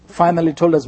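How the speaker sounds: ordinary talk, nothing marked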